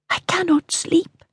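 high, soft plea; queen confessing to Mortimer; restless queen. A narrator's reading. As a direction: let the sleeplessness show. Queen speaks.

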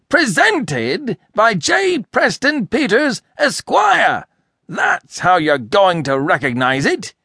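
very high, outraged, slow name by name; choking indignation. A narrator's reading. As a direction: splutter each name in outrage. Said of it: "Mister Peters choked".

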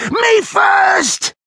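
very high shouted demand; frantic, high-pitched crook; panicked cry. A narrator's reading. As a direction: yell it while shoving for the exit. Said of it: "who cried".

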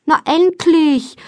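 high, drawn-out relief; exasperated greeting. A narrator's reading. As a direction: stretch it out like long waiting. Friend calls from the horse box.

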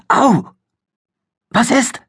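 high startled ouch then worried question; sudden pain yelp. A narrator's reading. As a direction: cry out as a thorn pricks. Argini sticht sich am Busch. (plötzlicher Schmerzensschrei, hoch)

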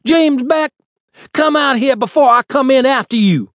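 high, loud bellowed challenge from the street; yelled threat. A narrator's reading. Huge thug calling Beck out, loud yelled threat. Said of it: "thug who yelled".

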